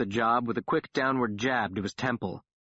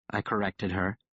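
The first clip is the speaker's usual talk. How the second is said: ordinary delivery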